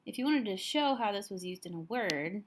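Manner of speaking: ordinary delivery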